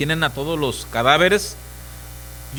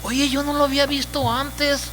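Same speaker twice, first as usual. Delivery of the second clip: surprised discovery; 9 semitones higher than usual. Acted. Believer with God's eyes sees the world, high and stunned. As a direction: gasp at the mud you never saw.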